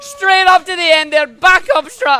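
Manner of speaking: high, very loud triumphant commentary; excited shout. Real, not acted. Speedrunner landing the risky bad strat, elated shouting. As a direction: shout it out like a winning goal. Risky trick lands mid-run.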